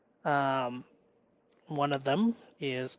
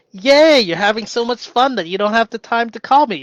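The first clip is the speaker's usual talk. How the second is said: loud, high happy reply; cheerful loud reply to partner; cheery yay; 5 semitones higher, 9 dB louder than usual. Retold or imitated. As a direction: cheer her on from far away. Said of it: "I was like".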